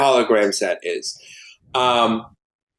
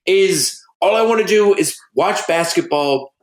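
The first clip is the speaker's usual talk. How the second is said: laid-back John Carpenter impression; 7 semitones higher than usual. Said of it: "He's like".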